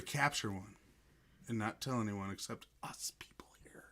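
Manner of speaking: whispering. Acted. Secret just among them.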